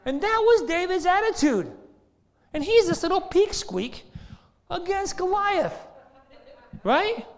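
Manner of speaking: high, loud, driving delivery; fervent preaching. Real, not acted. Preacher comparing David to Goliath, loud and high. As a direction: keep the fire going. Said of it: "preaching continues".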